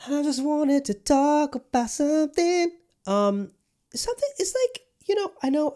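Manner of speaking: drawn-out cartoon squeak on the same words; squeaky high voice. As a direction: repeat the line in a silly falsetto. Repeats the line in a squeaky voice.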